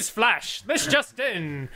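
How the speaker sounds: put-on slow, old-time newsreel announcer; 1940s news bulletin. Podcast host mimicking old radio news for his cohosts. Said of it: "it's the news bulletin".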